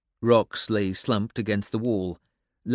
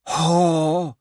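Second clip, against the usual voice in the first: wheezed haw; 6 semitones higher, 5 dB louder than usual. A narrator's reading. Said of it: "the old man wheezed".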